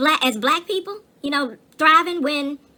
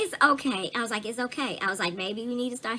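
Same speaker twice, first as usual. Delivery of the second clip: soft reassurance; 4 semitones lower, 9 dB quieter than usual. Retold or imitated. Comforting upset husband, retold.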